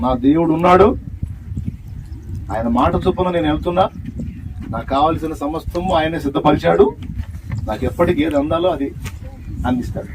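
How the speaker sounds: fast, fearless faith shout; bold declaration. Shown as a demonstration. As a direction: proclaim it boldly to the whole church. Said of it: "అని ధైర్యంగా చెప్పాలి".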